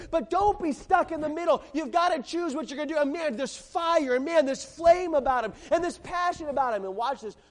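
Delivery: loud, high, driving pulpit preaching; preaching at full force. Acted. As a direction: preach it with fire to the whole church.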